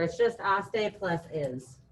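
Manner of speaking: slow, spelled-out explanation; deliberate classroom pace; slow teaching voice. Shown as a demonstration. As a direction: lay out "aste plus is" slowly for the class. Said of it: "teacher explaining a verb ending".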